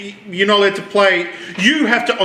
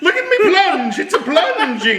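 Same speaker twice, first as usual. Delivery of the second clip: high, laughing Italian-American Luigi voice; Italian-American plumber, hammed up; Italian-American accent; 11 semitones higher than usual.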